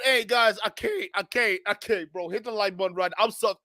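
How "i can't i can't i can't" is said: usual speaking voice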